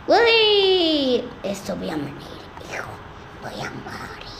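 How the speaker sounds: fading, strangled death moan sinking to a whisper; hammed-up dying groan; mock dying. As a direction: play a ragdoll breathing its last. Character dying in the game.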